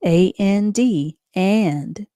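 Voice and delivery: usual speaking voice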